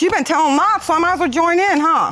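sarcastic jab. Real, not acted. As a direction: throw the lie back mockingly. Sarcastic retort in court quarrel.

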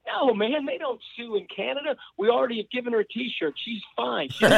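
imitating amused Canadians, pitched high; amused mock reassurance. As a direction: brush it off in a high, lightly laughing voice. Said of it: "all the Canadians were laughing at me".

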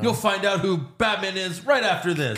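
high, hammed-up broadcast teaser; TV host. Host teasing viewers before a commercial break, hammed-up and high. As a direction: sell the cliffhanger before the break, high-pitched.

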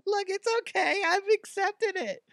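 a silly high-pitched guy proudly confessing; squeaky guy voice. Retold or imitated. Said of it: "Be like".

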